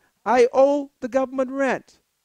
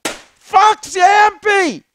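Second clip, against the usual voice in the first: high, loud, slow shouted scolding; 6 semitones higher, 7 dB louder, slower than usual. Retold or imitated. Scolding the evicted woman she wanted to curse out, shouting. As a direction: bellow it at her. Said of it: "I wanted to say to her".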